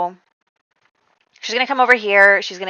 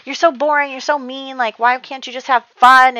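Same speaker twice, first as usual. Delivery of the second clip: put-on bratty kid whine; 7 semitones higher, 4 dB louder than usual.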